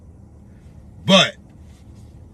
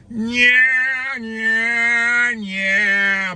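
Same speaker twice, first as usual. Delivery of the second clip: sarcastic yeah; 7 semitones higher, much slower than usual. Real, not acted. Mocking the reparations plan.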